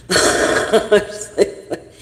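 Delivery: laughing through the words, breathless and slow; real laugh breaking up the words. Real, not acted. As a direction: laugh while recalling a bad scare. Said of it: "I'm laughing now".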